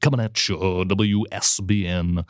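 low, drawn-out trailer announcer; movie trailer voice. Pretend. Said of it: "like, trailer voice".

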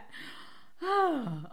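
long sigh after a laughing fit; real winded sigh; recovering sigh. Real, not acted. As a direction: let the laughter out in one breath.

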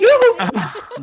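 high, happy yoo-hoo; excited cheer. Real, not acted. Cheering about being remembered.

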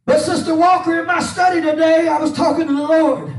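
rhythmic preaching with an "uh" on each breath; full-force preaching. Acted. Pastor preaching to his church, full-force and rhythmic. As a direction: preach it out to the church over the organ. Preaching over organ music.

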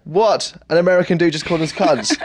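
high-pitched mock astonishment; retold disbelief. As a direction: act out being taken aback. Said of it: "And we're like".

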